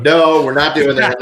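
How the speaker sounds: loud, flat no; firm refusal. Retold or imitated. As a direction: shut the idea down loudly.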